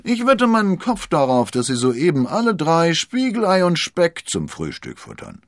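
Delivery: high, loud, cheery servant voice; bright-pitched, boisterous reassurance; consoling servant. A narrator's reading. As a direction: bet on it heartily to cheer the master up.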